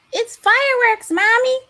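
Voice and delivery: put-on high and loud, like a thrilled kid; excited child voice. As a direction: imitate a little boy yelling. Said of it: "my baby ... would be going".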